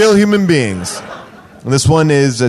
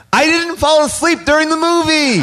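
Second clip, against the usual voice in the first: mock reviewer voice; 9 semitones higher than usual. Reading review in put-on voice.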